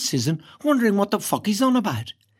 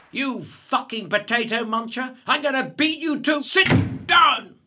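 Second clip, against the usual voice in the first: slow threat rising into a shout; bully's angry threat; 4 semitones higher, slower than usual. A narrator's reading. Bully coming to get Irish boy, angry threat. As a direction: build from drawn-out menace to a higher yell.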